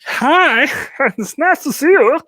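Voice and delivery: high, slow squeaky dog voice. Pretend. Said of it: "that's how that dog speaks".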